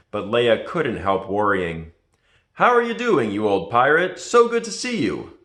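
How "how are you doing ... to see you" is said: a lightly voiced jovial Lando, high and loud; hearty greeting. A narrator's reading. Lando greets his old friend Han, hearty and high. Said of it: "Lando said to Han".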